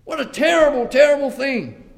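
loud, high outraged cry; David's loud indignant outburst; angry. Acted. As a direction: rage at the rich man. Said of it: "And he's angry".

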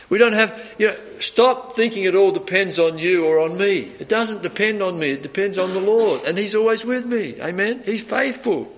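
usual speaking voice

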